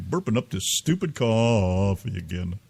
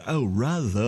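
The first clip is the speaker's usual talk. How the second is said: put-on posh British "rather"; playful imitation of posh British; mock posh British; slower than usual. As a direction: say it like a toffee-nosed posh Brit. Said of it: "Very posh".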